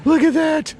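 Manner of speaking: very high, thrilled exclamation; overjoyed squeal. Real, not acted. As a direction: squeal in giddy disbelief at a find. Overwhelmed by the gold find.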